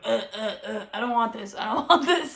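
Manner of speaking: lightly imitated baby gagging on medicine; choking baby. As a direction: play a fussing baby, half laughing. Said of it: "she's, like, choking".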